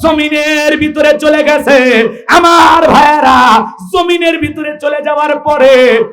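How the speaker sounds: high, ringing sermon storytelling; full-force preaching. Acted. Preacher tells of the prophet's anger, high and impassioned. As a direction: carry the tale out to the crowd.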